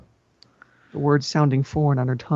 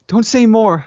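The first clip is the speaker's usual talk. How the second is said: sobbed; 7 semitones higher, 5 dB louder, slower than usual. Acted. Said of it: "I sobbed".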